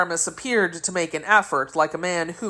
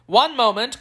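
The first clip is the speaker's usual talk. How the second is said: higher, mild call to halt; lightly raised storyteller's cry; cried out; 7 semitones higher, 3 dB louder than usual. A narrator's reading. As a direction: call out to stop them. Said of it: "cried D'Artagnan".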